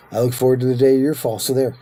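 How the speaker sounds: hurried, petty comeback; quick mock retort. Repeats the threat as a retort.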